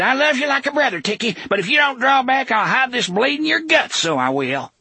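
high breathless threat. A narrator's reading. Gasher panting threat.

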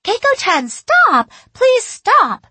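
put-on very high, loud girlish pleading; tearful shout. A narrator's reading. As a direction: scream a desperate plea like a schoolgirl. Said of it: "the girls shouted at me tearfully".

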